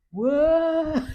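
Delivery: loud, high wailing cry; imitated toddler wail. Mother imitating her son's loud crying with a wail.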